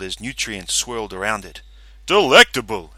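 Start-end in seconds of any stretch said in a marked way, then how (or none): 2.0-2.9 brain character voice. Acted. Brain speaks through speaker.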